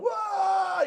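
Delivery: very high joyful whoop; thrilled shout. Retold or imitated. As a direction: whoop on the drop. Speaker yells on the drop.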